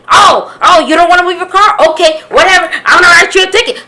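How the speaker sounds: loud, high imitation of a cop's tantrum; going off.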